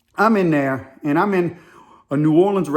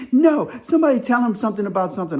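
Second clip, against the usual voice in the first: higher mock-insistent protest; imitated worked-up woman; 7 semitones higher, faster than usual. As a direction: cut in with her mildly mimicked outrage. Acts woman refusing to calm down.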